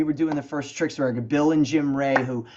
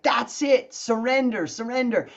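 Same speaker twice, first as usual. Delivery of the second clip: higher, eager repeat of the title; excited; 7 semitones higher than usual. Retold or imitated. Singer recalls running into the booth, excited and higher. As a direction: jump on it with excitement.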